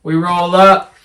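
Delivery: ordinary talk, nothing marked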